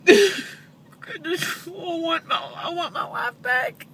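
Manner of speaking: sobbing, high and loud; wailing through tears; snotty crying. Real, not acted. Grieving mother breaks down.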